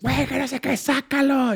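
high-pitched, held-back mock shout; imitated distant yell. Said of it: "a mí empezó a gritar Marín desde el, desde el balcón". (grito lejano imitado, agudo y bajito)

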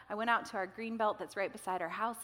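ordinary delivery

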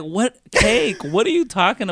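retelling a confused reply to the boss; mock confusion. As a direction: play dumb, a bit loud. Retold confused reply on phone.

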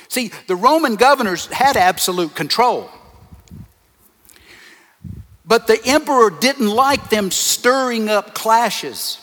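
loud, high-pitched sermon delivery; forceful preaching. Real, not acted. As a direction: drive each point home to the congregation, loudly.

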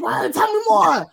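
high, eager pleading for gossip. Retold or imitated. Mother egging her son on for gossip, very high and eager. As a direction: beg for the juicy details. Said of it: "and I'm be like".

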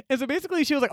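ordinary talk

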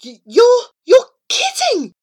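high, loud stammer; flabbergasted. A narrator's reading. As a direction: stumble into astonished disbelief. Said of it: "mouth flapping like a dying fish".

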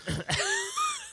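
a squeaky, high laugh; high burst of laughter. Real, not acted. Cohost bursting out laughing at the host's story, high squeal.

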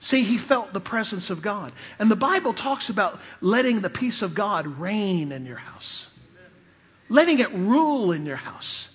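ordinary talk, nothing marked

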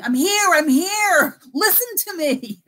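high, slow pleading call from behind glass; mock ghost calling to be heard; a pleading spirit, drawn out.

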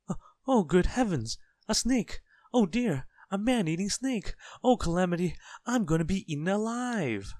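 high, loud wailing terror; hysterical panic. A narrator's reading. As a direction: shriek each phrase as if about to be eaten. Mister Jelly panics at a worm.

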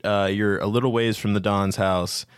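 ordinary talk, nothing marked

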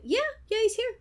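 very high-pitched mock answer; awkward phone reply. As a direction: answer in a squeaky-high retold voice. Acted reply to the odd caller.

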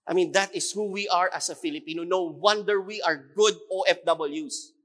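ordinary talk

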